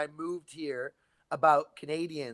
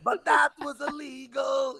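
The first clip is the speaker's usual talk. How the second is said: slow, high mock outrage; mock outraged shout; 9 semitones higher, slower than usual. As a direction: cry out in fake scandal.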